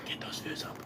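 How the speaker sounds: breathy whispered aside. Real, not acted. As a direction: whisper it like a secret.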